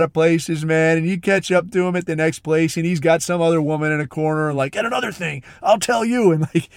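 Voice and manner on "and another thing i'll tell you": mock drunken rant. Bipolar guy ranting at woman.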